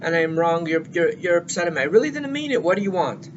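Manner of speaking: loud, high, pleading shout of frustration; exasperated yelling. Retold or imitated. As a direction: yell it like a cornered partner who already apologized. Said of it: "the person that apologizes starts yelling".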